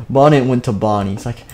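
ordinary delivery

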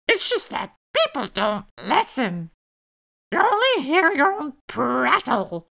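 high bird voice, lecturing; screechy blue jay. A narrator's reading. Jay lecturing Elf on listening, screechy.

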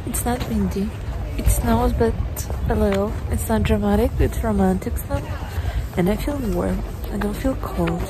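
ordinary talk, nothing marked

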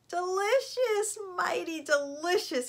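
high, slow, savoring exclamation; delighted crocodile. A narrator's reading. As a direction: relish it like a pleased crocodile. Crocodile tastes the lollipop.

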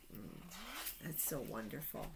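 ordinary talk

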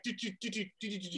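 soft, rapid "do-do-do" stutter; hushed sound-effect patter; imitated dragging feet. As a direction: quietly mimic feet skittering along. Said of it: "He was like".

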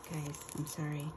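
usual speaking voice

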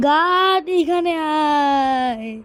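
loud, high summons with a long drawn-out end; exasperated shout for security; calling out. Acted. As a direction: yell for the guard, stretching the last word. Teacher summons the guard.

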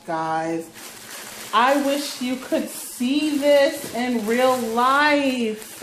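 slow, breathless awe; awed gushing. Real, not acted. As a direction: marvel at it, drawn out. Gushing over the new coat.

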